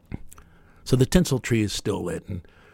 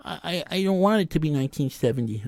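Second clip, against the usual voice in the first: higher, a lightly voiced crying child pleading with mommy; whiny little kid; 7 semitones higher than usual. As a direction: whimper like a small boy who doesn't want the year to change. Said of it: "and I cried my little eyes out".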